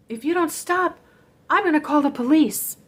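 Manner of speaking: acted-out ultimatum; firm warning. Re-enacted threat to her mother.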